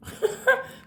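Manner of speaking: quick, high breathless giggle. Real, not acted. Reader giggles at the cards that turned up, high and breathless. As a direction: let a surprised laugh escape.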